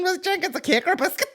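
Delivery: very high, rushed silly voice; hammed-up, fast, high-pitched pestering; mock questioner. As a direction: fire off the question in a squeaky mock voice. Mocking a silly podcast question.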